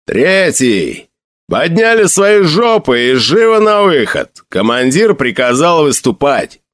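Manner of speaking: sergeant's rousing yell, rising high midway; booming veteran's bellow. A narrator's reading. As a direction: holler orders at the troops. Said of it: "завопил громким басом".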